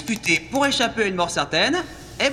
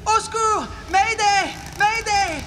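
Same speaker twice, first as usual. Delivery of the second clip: panicked screaming; 13 semitones higher, slower than usual. Acted. Repeats panic after evil laugh.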